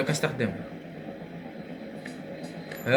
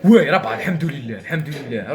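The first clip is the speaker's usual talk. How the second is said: usual speaking voice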